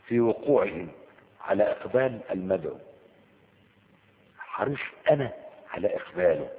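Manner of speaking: ordinary talk, nothing marked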